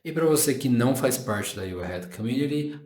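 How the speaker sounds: ordinary delivery, nothing marked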